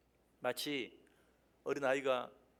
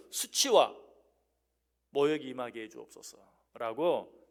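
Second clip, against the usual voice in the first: bold prayer; 3 semitones lower, 5 dB louder, slower than usual. Acted. David asking God to shame accusers, bold and loud. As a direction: hurl the word out in prayer. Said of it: "하나님께 담대하게 기도하는".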